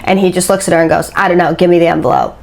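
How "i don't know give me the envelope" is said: quick, offhand delivery; fast, dismissive demand in a retelling; retold brisk demand. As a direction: rattle it off like it hardly matters. Retold man's first offhand demand.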